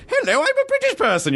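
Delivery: very high, prim British voice; posh British voice. Retold or imitated. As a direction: chirp it like a stuffy Brit. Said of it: "I've been like".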